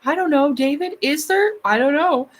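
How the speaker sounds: lightly voiced imitation of a coy, teasing guru; mocking David Wilcock. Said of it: "David Wilcock took advantage of it and is like".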